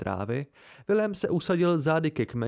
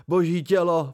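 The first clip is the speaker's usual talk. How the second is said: loud, higher-pitched cry of sudden panic; startled yelp; 4 semitones higher, 7 dB louder than usual. A narrator's reading. Narrator waking in panic over his lute, startled yelp. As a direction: yelp it out in alarm. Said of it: "vyjekl jsem".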